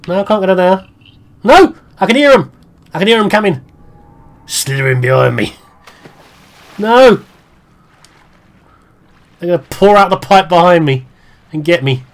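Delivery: frantic fear of being caught; panicked. Real, not acted. Panicking as monsters approach.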